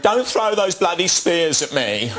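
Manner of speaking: high, slow, laughing Michael Caine impression; a mock Michael Caine line from Zulu, through laughter; imitating a film officer while laughing. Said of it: "he's doing Michael Caine in Zulu".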